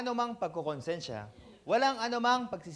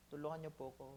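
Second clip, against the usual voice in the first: soft, broken-sounding plea for help; desperate plea; 6 semitones lower, 15 dB quieter, faster than usual. Retold or imitated. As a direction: beg for help in a small voice. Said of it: "hindi naman niya po sinabi na". (mahinang desperadong pakiusap)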